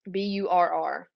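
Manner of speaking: spelling burr letter by letter; spelled out. Shown as a demonstration. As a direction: say B U R R as separate letters. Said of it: "get rid of burr comb".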